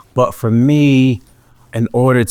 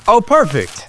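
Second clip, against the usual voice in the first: delighted; 12 semitones higher than usual. Acted. Mock delight at shirtless rule.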